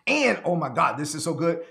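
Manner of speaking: ordinary delivery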